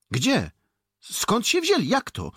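loud, high, startled questioning; flurry of questions. A narrator's reading. Soldiers pepper the boys about Cossacks, excited. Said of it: "posypały się pytania".

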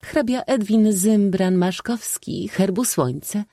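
high, slow ceremonial call; self-important announcer. A narrator's reading. Old maid absorbed in her role summoning the count, grand announcing. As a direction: proclaim the name in a high, drawn-out herald's voice. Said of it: "wołała zupełnie swą rolą przejęta".

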